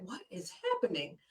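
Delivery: barely voiced inner bewilderment; inner thought. New commander thinking to herself, quietly baffled. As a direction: mutter it to yourself, baffled. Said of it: "still in my mind".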